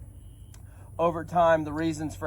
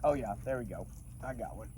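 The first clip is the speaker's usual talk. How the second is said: soft murmur while gripping a fish; holding a fish; 17 dB quieter than usual. Real, not acted. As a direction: coo to the fish in your hands. Landing and handling a fish.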